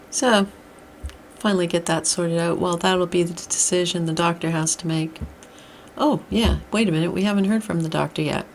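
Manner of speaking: ordinary talk, nothing marked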